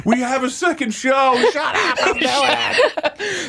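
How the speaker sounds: high, desperate comic wail; hammed-up tearful rant; mock crying. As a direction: squeal it in weepy defiance. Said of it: "You're crying like".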